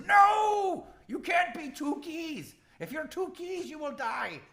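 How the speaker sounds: high troll cry, opening on a loud shouted no; very high-pitched, panicky troll; alarmed troll. Acted. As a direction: shriek the first word, then warn in a high, urgent creature voice. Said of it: "he rushes over and grabs your hand and says".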